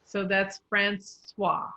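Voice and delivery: "Francois" with the OIS as "wa", slow and careful; Francois said slowly. Shown as a demonstration. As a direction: spell out "Francois" as fran-swa, unhurried. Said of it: "Anytime you see an O I S, it becomes a W A".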